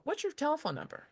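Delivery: high, lilting casual question; natural inflection. Shown as a demonstration. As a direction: ask "What's your telephone number" with lively high inflection. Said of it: "with an inflection in my voice".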